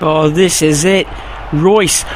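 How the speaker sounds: usual speaking voice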